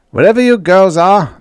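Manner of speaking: loud, high, urgent command; barked order. A narrator's reading. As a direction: bark it over the radio. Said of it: "the radio barked".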